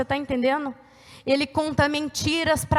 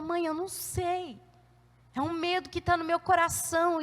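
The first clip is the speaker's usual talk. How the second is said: fearful confiding; 9 dB quieter than usual. Retold or imitated. Daughter admitting fear to her mother, hushed. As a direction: quietly voice a daughter unsure of herself.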